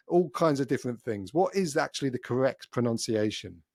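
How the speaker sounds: ordinary delivery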